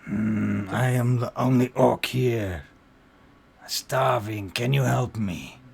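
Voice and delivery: loud, pleading orc voice; starving orc child. Acted.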